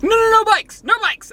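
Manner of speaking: high, loud panicked worrywart; worried parent. Retold or imitated. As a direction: shriek it like a nervous parent. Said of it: "You're gonna be like".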